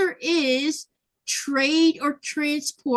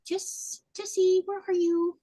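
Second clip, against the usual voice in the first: calling Jessie in a raised, high voice; 8 semitones higher than usual. Real, not acted. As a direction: sing out her name. Calling to a viewer.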